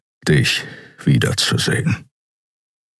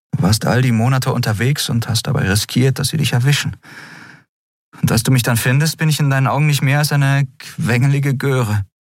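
a hint of a higher girl's voice, hurt; lightly voiced young woman; 10 semitones higher, faster than usual. A narrator's reading. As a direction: voice Endriel a little higher, reproachful. Female character voiced high. (leicht höher gesprochene junge Frau, vorwurfsvoll)

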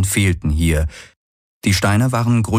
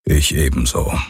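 deep, subdued grumble; low grumble; 7 semitones lower than usual. A narrator's reading. Hox shares Galen's worried hope, grumbling low. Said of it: "brummte Hox".

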